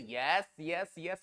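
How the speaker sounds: ordinary talk, nothing marked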